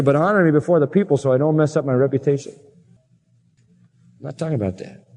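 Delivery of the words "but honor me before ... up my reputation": usual speaking voice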